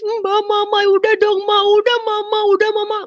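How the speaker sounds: high, loud pleading wail; acted-out tearful begging; imitated crying. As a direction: plead like a crying younger sibling. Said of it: "Dia nangis".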